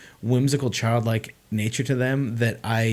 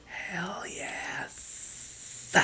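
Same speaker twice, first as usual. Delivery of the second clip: long, drawn-out whisper; whispered relish; 8 semitones higher, 17 dB quieter than usual. Real, not acted. Fan savoring his favorite director's adventure story, slow drawn-out whisper. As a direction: whisper it slowly, stretching each word out.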